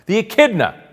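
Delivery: high, loud announcement of the name; mock-triumphant exclamation; emphatic proclamation. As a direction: proclaim it like a grand reveal. Triumphantly naming his animal again.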